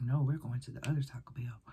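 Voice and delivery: flat hushed whisper; whispered insistence. Retold or imitated. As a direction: whisper the line, eerie and firm. Friend's insistence retold as whisper.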